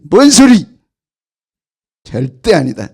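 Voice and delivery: loud, high outburst; angry denial. Acted. Peter shouting his denial at the servant girl. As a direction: snap back in denial.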